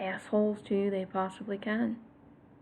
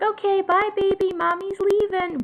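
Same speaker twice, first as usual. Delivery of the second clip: high, loud fake goodbye to a toddler; sing-song bluff of leaving, pitched way up; mommy calling bye to her toddler, high and loud; 11 semitones higher, 5 dB louder than usual. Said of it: "tried to do the fake out".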